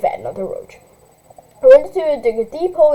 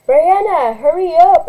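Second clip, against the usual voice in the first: loud call, pitched up; shouting through door; 6 semitones higher, 5 dB louder, slower than usual. Acted. Big sister shouting at little sister through door, loud. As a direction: yell it through the bathroom door. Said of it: "I shouted through the door".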